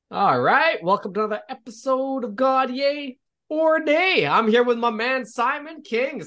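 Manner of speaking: high, bright announcer opener; podcast host intro. Acted. Host welcoming his guest to the podcast, high announcer lift. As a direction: pump up the show's opening like a host.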